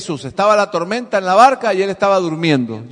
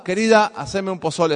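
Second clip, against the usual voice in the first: plain, steady adult man asking his wife; lightly voiced husband, normal adult tone; grown man's voice. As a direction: ask like a grown man would. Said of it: "así con esa voz de hombre que tiene".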